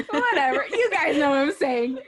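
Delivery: high and loud through giggles; laughing dismissal. Real, not acted. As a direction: brush off the slip while laughing. Giggling over a muddled word.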